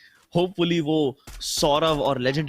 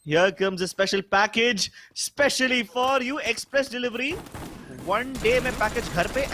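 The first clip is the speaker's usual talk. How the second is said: ad announcer; 5 semitones higher than usual. Pretend. Playful delivery-ad pitch.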